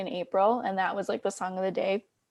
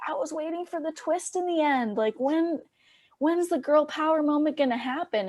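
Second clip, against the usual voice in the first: high, put-on voice of a puzzled audience member; parroting a concertgoer's question in a higher voice; mimicking the lady; 6 semitones higher than usual. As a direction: play the earnest fan asking for more. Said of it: "this lady came up to me after and was like".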